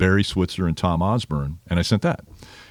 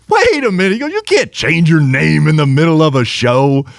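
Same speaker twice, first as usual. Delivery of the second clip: high, louder amused objection; amused protest; 8 semitones higher, 6 dB louder than usual. Retold or imitated. Darrell laughing as the announcer keeps changing his name, high amused protest. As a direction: protest through a laugh, pitched up. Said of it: "Darrell just starts laughing".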